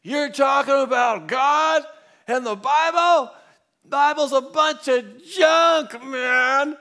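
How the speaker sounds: put-on high, slow, bellowing drunk; loud drunk guy. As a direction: yell it like a bombastic drunk in the street. Drunk man yelling about the Bible.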